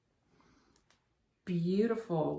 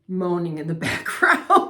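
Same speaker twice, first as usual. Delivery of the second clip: laughing; 9 dB louder than usual. Real, not acted. Laughing at husband's moaning.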